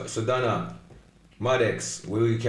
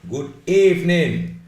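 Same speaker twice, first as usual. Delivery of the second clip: higher, louder, slow announcer-style good evening; grand drawn-out greeting; 7 semitones higher, 7 dB louder, slower than usual. Shown as a demonstration. Host booming a grand welcome to the viewers. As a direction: stretch it out like a showman welcoming the crowd.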